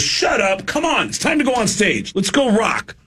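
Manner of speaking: high mock brush-off; dismissive drummer. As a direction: wave the band away, pretending not to care.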